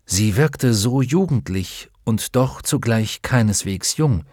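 ordinary delivery, nothing marked

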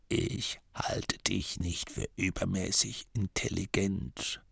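dying abbot's whisper. A narrator's reading. The dying abbot whispering a secret into Masklin's ear. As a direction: breathe it out like a frail dying old man.

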